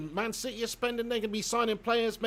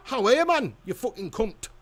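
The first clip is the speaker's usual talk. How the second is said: punchy attempt at a Geordie accent; rough-and-ready comic Geordie; 4 semitones higher, 5 dB louder than usual. Shown as a demonstration. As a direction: do your best Geordie, opening high and loud. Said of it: "Do your best Geordie accent".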